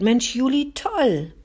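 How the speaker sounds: high, loud burst of admiration; excited praise. A narrator's reading. Britta praises the boy while ruffling his hair, excited praise. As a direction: cheer him on, pitched right up.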